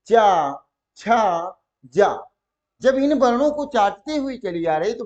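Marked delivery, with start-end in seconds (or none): 0.1-2.3 चा, छा, जा with tongue sliding on the palate; palatal sound demonstration. Shown as a demonstration. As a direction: sound out each syllable separately. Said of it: "चाटती हुई चली आ रही है".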